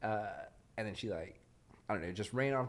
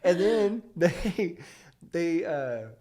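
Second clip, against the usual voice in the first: laughing while telling it; words broken by laughter; real laugh spilling through; 7 semitones higher, 10 dB louder, much slower than usual. Real, not acted. Laughing retelling the news.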